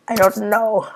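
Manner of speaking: squeaky-high indecision; high-pitched dithering. Real, not acted. Player unsure of a name, high-pitched outburst. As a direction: jump up into a high, loud voice. Dithers over naming the character.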